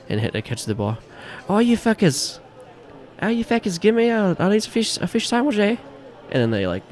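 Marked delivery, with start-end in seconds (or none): 1.5-5.8 high-pitched, hammed-up Irish yelling; very thick Irish. Bartender yelling to the other Irish guy, very thick Irish. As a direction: holler an order in an impenetrable Irish brogue. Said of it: "They're so Irish".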